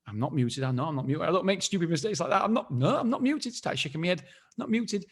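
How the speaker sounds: mock indignant denial. Denying to the host he's muted, pitch climbing. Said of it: "I was like".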